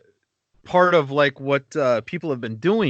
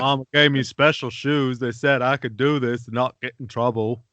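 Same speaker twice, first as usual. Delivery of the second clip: Forrest Gump. Pretend. Comic riffing to a co-host, Gump voice. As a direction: draw it out as a mock Gump. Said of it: "doing the Gump voice".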